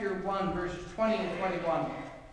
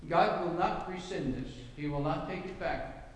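usual speaking voice

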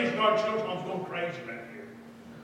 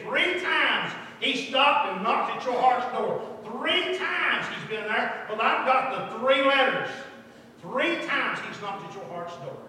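loud, slow revival preaching; emphatic preaching; 5 semitones higher, 7 dB louder, slower than usual. Real, not acted. Preacher urging a girl about God's knocking, emphatic. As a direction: punch out each phrase, high and drawn out. Preaches within the retold talk.

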